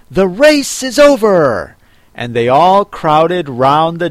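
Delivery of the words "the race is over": loud, high announcement; calling out. A narrator's reading. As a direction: call it out suddenly to everyone. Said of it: "the Dodo suddenly called out".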